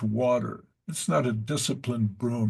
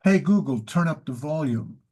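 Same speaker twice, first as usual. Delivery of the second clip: calling out; 2 semitones higher, 3 dB louder than usual. Real, not acted. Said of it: "commanding the smart speaker".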